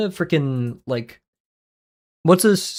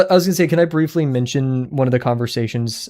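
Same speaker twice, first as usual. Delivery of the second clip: ordinary talk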